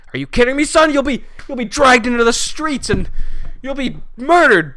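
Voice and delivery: hammed-up outraged dad yelling; mock furious father. Father yells at son about moving to LA, mock shout. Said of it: "He's like".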